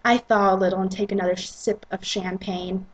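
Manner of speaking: ordinary delivery, nothing marked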